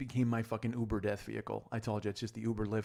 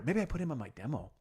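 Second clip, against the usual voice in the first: ordinary delivery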